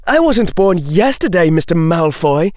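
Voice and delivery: high, heated sarcastic retort; angry scorn. A narrator's reading. Daphne rejecting Malfoy's defence at wandpoint, angry scorn. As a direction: throw it back at him high and scornful.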